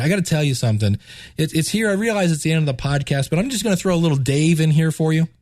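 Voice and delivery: normal talking voice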